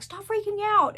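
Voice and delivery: hammed-up snap at a panicking friend; shaking someone, telling them to calm down; mock scolding. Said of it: "will shake them and be like".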